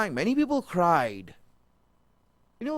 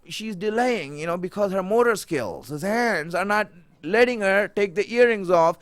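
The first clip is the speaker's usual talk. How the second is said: ordinary delivery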